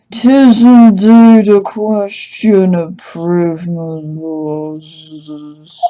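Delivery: put-on slow, grand old-fashioned speech; slow pompous inquisitor. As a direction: drawl it like a stately judge. Voicing the sleepy inquisitor.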